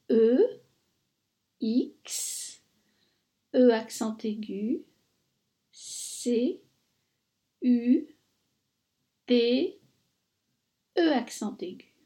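spelling exécuter letter by letter. Shown as a demonstration. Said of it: "Je vous l'épelle".

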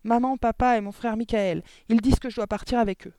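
put-on high, loud, upset child; urgent distress. A narrator's reading. Girl telling her teacher she must go too, distressed.

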